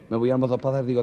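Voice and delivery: ordinary delivery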